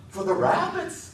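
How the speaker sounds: high, slow excited shout. A narrator's reading. Lenny shouting eagerly to George, high. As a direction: burst out with glee. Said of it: "Lenny shouted".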